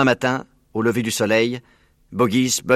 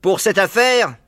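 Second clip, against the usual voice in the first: high, loud character voice; commanding farmer; 9 semitones higher, 6 dB louder, slower than usual. A narrator's reading. Bean proposing a plan, loud and high. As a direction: announce the plan forcefully.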